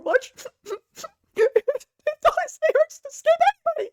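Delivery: squeaky high whine; high, squealy mock-anguished voice; cute anime streamer. High squealing whine about skipping characters.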